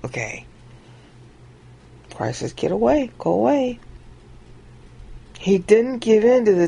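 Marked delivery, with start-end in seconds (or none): none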